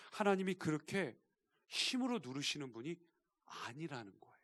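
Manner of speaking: ordinary delivery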